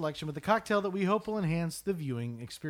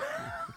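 burst of high laughter; 7 dB quieter than usual. Real, not acted. Host breaks up mid-intro.

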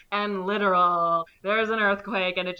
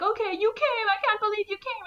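much higher, mock-amazed voice; mock surprised son; 13 semitones higher, faster than usual. Boxer son amazed dad came, much higher mock-amazed voice. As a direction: act stunned your dad showed up. Boxer greeting his father.

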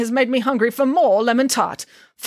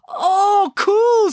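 high, loud happy whoop; a simpleton suddenly thrilled; high loud goofy cheer; dim delighted Hercules; 14 semitones higher, 6 dB louder than usual. Acted.

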